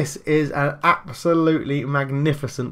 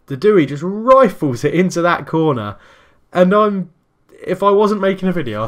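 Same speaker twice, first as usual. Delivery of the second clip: loud, higher excited call; excited goal call; 8 semitones higher, 9 dB louder than usual. Real, not acted. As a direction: still buzzing from the goal. After another goal.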